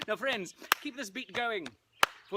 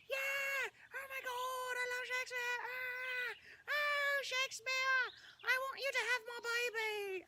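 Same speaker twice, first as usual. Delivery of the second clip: much higher shrieking fan voices; screaming fangirl crowd; slower than usual. Pretend. Performer faking the audience's screams for himself, shrill and high. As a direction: squeal like a swooning crowd.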